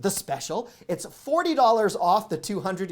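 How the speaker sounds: loud, slow salesman pitch; used car salesman. Real, not acted. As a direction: sell it like a car dealer. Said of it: "I sound like a used car salesman".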